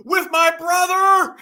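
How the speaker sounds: high, loud, drawn-out mock wail; mock outrage. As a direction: overplay the betrayed man, slowly. Mocking upset man's meltdown.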